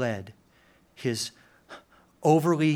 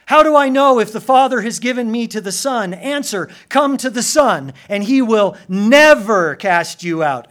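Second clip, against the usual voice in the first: louder, higher ringing call; full-force preaching; 7 semitones higher, 12 dB louder than usual. Acted. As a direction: drive the answer home.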